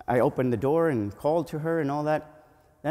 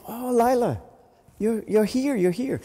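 relieved joy; 7 semitones higher than usual. Retold or imitated. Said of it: "I was so happy to see her".

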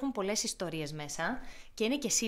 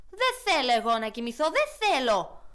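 stubborn little hedgehog yelling no; a child refusing bed, loudly; angry shout; 2 semitones higher, 7 dB louder than usual. Acted. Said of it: "φώναξε θυμωμένο".

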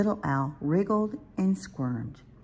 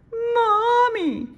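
put-on very high, loud drawn-out child's call; crying out; 8 dB louder than usual. A narrator's reading. Little Owl calls Mommy in the dark, crying out. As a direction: wail for mother in a little owl's voice. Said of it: "Little Owl cried".

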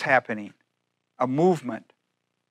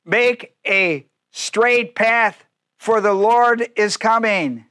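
calling out as John; 6 semitones higher, 3 dB louder, much slower than usual. Acted. Preacher voicing John's call.

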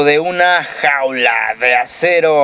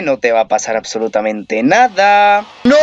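usual speaking voice

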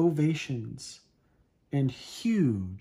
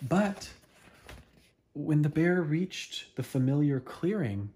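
ordinary delivery

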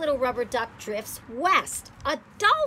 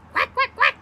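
high, loud little quacks; ducklings quacking; 14 semitones higher, 10 dB louder than usual. Acted. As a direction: chirp it like tiny ducklings. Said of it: "says the ducklings".

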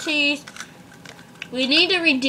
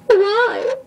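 crying; 6 semitones higher, 3 dB louder than usual. Acted. Acted despair, crying sound.

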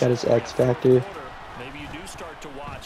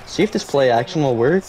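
ordinary talk

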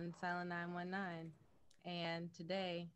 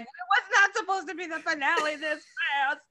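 loud, laughing, higher, overcome; emotional laughing quote; 9 semitones higher, 14 dB louder than usual. Retold or imitated. To the players, loud laughing near-tears quote. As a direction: blurt it out laughing through emotion. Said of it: "super emotional".

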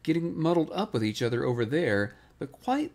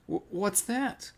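higher, slow, stammered question; startled stammer; 7 semitones higher, slower than usual. A narrator's reading. Piglet hears something while excusing himself, startled stammer. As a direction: stumble on the first word, mildly alarmed.

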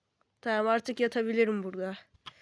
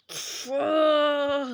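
hurt cry; 3 semitones higher than usual. Acted. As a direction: cry out as you get hit. Pained cry. (acıyla haykırış)

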